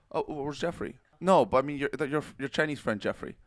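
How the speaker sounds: ordinary delivery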